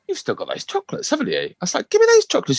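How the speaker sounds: high, rising to a loud demand. Retold or imitated. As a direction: build up to a shrill demand. Parent demanding chocolates.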